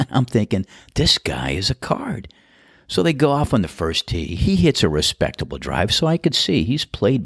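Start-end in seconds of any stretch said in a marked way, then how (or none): 0.9-2.3 amused whispered aside. Retold or imitated. Starter thinking to himself, amused whisper. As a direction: whisper it to yourself with a grin. Amused private thought while watching.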